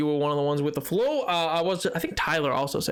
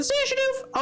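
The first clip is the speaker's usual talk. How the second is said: cheery high farewell. Real, not acted. Farewell to viewer.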